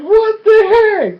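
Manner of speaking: high, loud, drawn-out mock wail; incredulous shriek. Viewer reacting to sudden bawling, incredulous shriek. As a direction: squeal it slowly in hammed-up disbelief.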